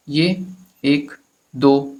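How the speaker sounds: slow, spaced-out reading of ये एक, दो; wrong way to read. Shown as a demonstration. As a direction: read ये एक, दो one slow word at a time with gaps. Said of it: "ऐसे नहीं पढ़ना है".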